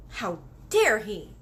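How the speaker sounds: indignant cry. Acted. Girl outraged at the giant's news. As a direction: spit out the outrage. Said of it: "Sophie cried".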